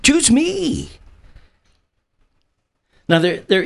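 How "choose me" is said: high-pitched, over-eager volunteer; eager kid. Acted. Preacher acting Isaiah waving his hand, eager kid. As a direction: beg to be chosen, squealing like an excited kid. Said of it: "becomes that eager kid in the front row".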